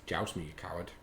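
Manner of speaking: low, very soft aside; muttered repeat. Real, not acted. Host repeating a viewer's challenge to himself, muttered. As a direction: say it quietly, almost to yourself.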